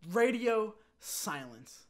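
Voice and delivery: drawn-out, very slow announcement; dramatic emphasis. Real, not acted. Storyteller reveals no reply ever came, dramatically drawn out. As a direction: stretch each word out for suspense.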